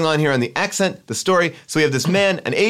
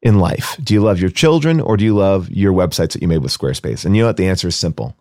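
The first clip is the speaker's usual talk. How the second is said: louder, pushy pitchman sponsor read; 2 semitones lower, 4 dB louder than usual. Acted. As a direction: sell it like a hammy host ad.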